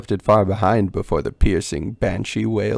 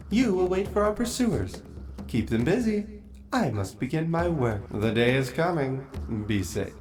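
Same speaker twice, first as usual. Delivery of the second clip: higher, cool orders; commanding vampire queen, light; 5 semitones higher, 6 dB quieter than usual. Acted. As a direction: send him off, in command.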